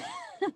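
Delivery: high, soft giddy giggle; a delighted little giggle; giddy laugh. Acted. Felicity giggles giddily as John fumbles his answer. Giddy after agreeing to kiss.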